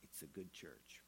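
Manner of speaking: soft, low, wistful; almost to himself, hushed; hushed sad aside. Acted. The elder mourning his church, hushed.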